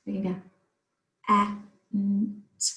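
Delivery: went sounded out as separate phonemes eh, mm, t; sounding out words. Shown as a demonstration. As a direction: pull each sound of went apart. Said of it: "We can also do the same with went".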